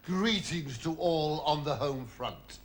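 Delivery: ordinary delivery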